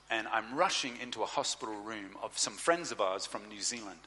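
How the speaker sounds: ordinary talk, nothing marked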